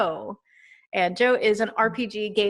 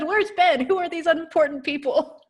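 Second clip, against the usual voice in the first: high, louder amused read-out; imitated viewer complaint, much higher; mock indignant viewer; 8 semitones higher, 3 dB louder than usual. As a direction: read it as an outraged commenter, pitched up. Reads viewer's teasing comment, amused.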